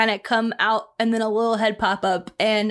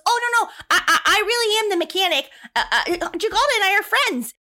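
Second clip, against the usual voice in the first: put-on high, flustered, stammering kid voice; a jittery boy caught out, stumbling over words; high nervous stammer; nervous young mechanic; 9 semitones higher than usual.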